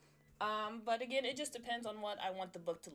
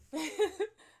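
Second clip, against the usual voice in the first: bright laugh; 8 semitones higher, 10 dB louder than usual. Real, not acted. Laugh while welcoming viewers.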